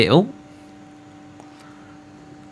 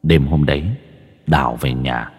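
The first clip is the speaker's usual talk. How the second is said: story narration; 6 semitones lower than usual. Acted. Rereads story again.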